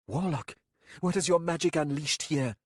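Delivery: alarmed demand. A narrator's reading. Captain demands answer.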